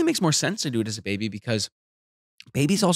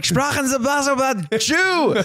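high, slow fake German accent; mock German Hitler; 13 semitones higher, slower than usual. Mocking Hitler in a high, slow German accent. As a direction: ham up a German dictator accent. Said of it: "He's like".

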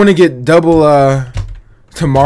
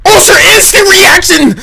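excited yelp; 11 semitones higher than usual. Real, not acted. Dodging skill in fight.

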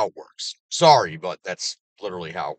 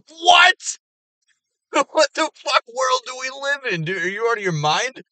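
much higher and louder, with a laugh; incredulous shout; 8 dB louder than usual. Real, not acted. Reacting to the prank caller, incredulous shouting with a laugh. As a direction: explode in disbelief.

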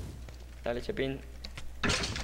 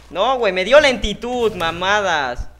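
louder, higher complaint; annoyed rant; 5 semitones higher, 7 dB louder than usual. Real, not acted. Gamer slowed down by an enemy kit, annoyed rant. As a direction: rant at the unfair power.

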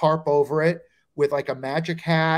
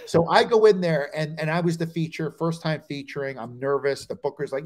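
ordinary talk, nothing marked

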